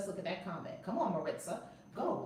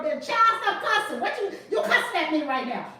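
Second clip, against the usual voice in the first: grandma scolding; 7 semitones higher, 11 dB louder than usual. Retold or imitated. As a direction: scold like an old southern grandma. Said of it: "she would go".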